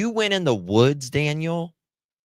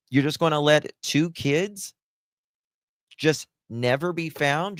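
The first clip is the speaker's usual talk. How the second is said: ordinary talk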